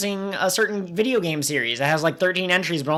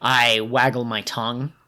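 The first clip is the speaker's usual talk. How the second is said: over enunciating; 6 semitones lower than usual. Retold or imitated. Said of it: "I don't enunciate worth crap".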